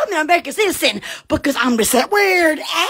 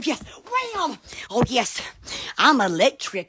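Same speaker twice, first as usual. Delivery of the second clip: ordinary talk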